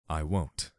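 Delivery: calm, firm "won't" stressed. A narrator's reading. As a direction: lean on "won't" calmly. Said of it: "just as calmly as before".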